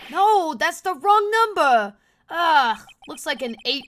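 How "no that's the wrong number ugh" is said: loud, high, drawn-out protest; acted outraged cry; worked up. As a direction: wail at the wrong result. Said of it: "He's getting really worked up about something".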